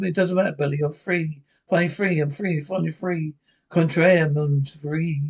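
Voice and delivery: usual speaking voice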